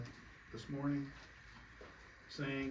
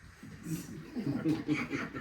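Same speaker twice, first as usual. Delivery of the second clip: real burst of laughter; amused laugh; 2 semitones higher, 5 dB louder than usual. Real, not acted. Listener laughs at offer to kiss their feet, amused laugh.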